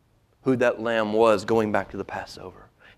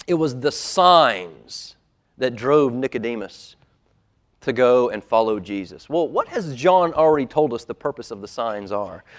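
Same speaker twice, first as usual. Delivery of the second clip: ordinary delivery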